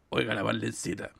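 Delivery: put-on low, soft lecherous old man. Shown as a demonstration. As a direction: croon it like a drunk uncle. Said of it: "hablo con mi voz de".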